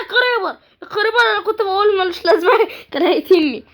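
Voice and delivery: high startled cry breaking into laughter; startled alarm. Real, not acted. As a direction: yelp the warning, then laugh it off. Startled by a creeper nearby.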